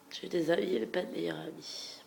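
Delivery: ordinary delivery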